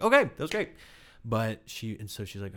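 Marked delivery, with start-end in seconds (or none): 0.0-0.7 much higher, louder chirpy voice; chirpy breezy praise she did not use; mock chirpy praise. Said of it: "not being like".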